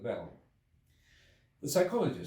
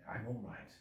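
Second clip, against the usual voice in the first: softer, weary reassurance; faltering; 7 dB quieter than usual. A narrator's reading. Exhausted traveller reassuring the guests, soft and faltering. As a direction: insist weakly he is fine. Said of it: "with a certain faltering articulation".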